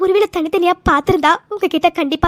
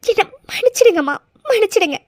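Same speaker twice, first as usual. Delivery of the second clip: begging forgiveness through tears; tearful, beseeching apology; tearful plea; 3 semitones higher than usual. Acted. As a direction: plead as if about to cry. Begging forgiveness, crying heard.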